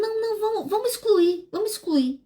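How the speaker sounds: mock the wife's urgent pleading; lightly voiced insistent wife; wife insisting. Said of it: "Ela falou".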